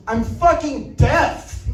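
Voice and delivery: slow, high, drawn-out mock snarl of the wife. The wife snapping at her husband in counseling, slow high mock. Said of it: "And she says".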